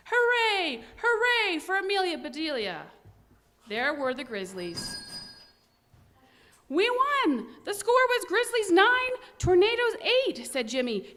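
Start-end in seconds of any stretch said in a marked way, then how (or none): 0.1-2.9 higher, lively cheer; a crowd cheering her name; cheering roar. Acted. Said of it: "a loud roar". 6.7-10.4 high, louder, thrilled announcing of the score; excited. Acted. Jimmy announces the win.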